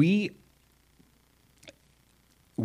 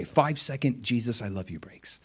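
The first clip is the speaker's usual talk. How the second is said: whisper; 6 dB quieter than usual. Acted. Whispered aside to congregation.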